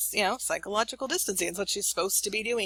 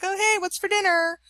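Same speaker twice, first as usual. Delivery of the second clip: put-on much higher, louder chirpy teen voice; breezy teen; 12 semitones higher, 5 dB louder than usual. Daughter casually asking mom about dinner, higher, louder. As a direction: act like nothing happened, all cheer.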